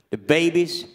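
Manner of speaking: ordinary talk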